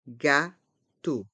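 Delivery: "Gâteau" in two separate beats; learner-paced, split "Gâteau"; syllable by syllable. Shown as a demonstration. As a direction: break "Gâteau" into gâ and teau. Teacher breaks word into syllables.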